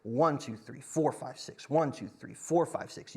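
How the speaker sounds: accent "One" and "four", let "five, six" fade to a breath; counting six with two pulses; compound feel. Shown as a demonstration. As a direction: stress on "One" and "four", trailing off. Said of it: "the feel".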